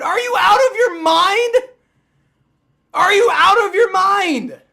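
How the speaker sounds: loud, high yell of disbelief; outraged shout. Real, not acted. Streamer yelling at refs after the replay, outraged shout. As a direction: bellow it at the screen.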